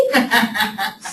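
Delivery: bright burst of laughter. Real, not acted. Teacher laughing at her own phone story, real laugh. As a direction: laugh at the caller's reaction.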